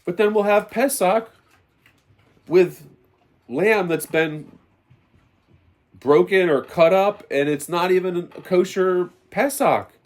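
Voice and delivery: fiery preaching. Acted. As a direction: preach it to the congregation with fire. Preaching, raised voice throughout.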